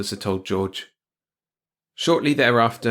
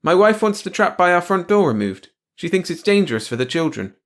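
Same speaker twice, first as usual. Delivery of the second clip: high, loud explanation; shouting back; 9 semitones higher, 7 dB louder than usual. A narrator's reading. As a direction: shout the family's request. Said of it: "George shouted back".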